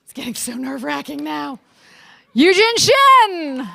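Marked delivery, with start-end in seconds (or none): 2.3-3.7 calling out names. Real, not acted. Match day name calling.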